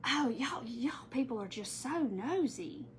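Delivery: soft, slow mumbled complaint under his breath; mumbling. Retold or imitated. Young cashier mumbling at shopper, slow and soft. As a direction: mumble it, barely audible. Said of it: "he was mumbling".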